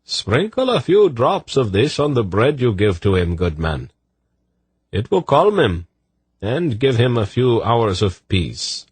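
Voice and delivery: high, lilting eunuch voice; eunuch healer. Acted. Eunuch telling the good man to dose bread, soothing. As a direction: instruct in a high, soothing lilt.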